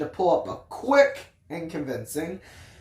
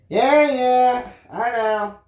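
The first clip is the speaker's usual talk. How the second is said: dismissive yeah yeah; 5 semitones higher, 3 dB louder, slower than usual. A narrator's reading. Narrator dismissing therapist's advice.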